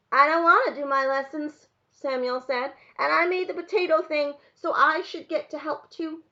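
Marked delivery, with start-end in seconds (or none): 0.1-1.7 put-on loud, high childish refusal; small boy digging his heels in; protesting little boy. A narrator's reading. 3.0-6.2 put-on high, loud, insistent child; small boy arguing his case; protesting little boy. A narrator's reading. Boy argues to be included.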